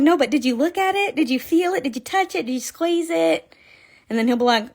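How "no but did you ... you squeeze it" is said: eager pleading. Retold or imitated. Pressing husband to admire crochet.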